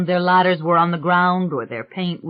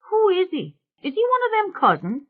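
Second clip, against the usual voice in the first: much higher-pitched workman's questions; suspicious questioning. Acted. Workman asks about the watching fella, suspicious and high. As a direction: pitch it up, demanding to know.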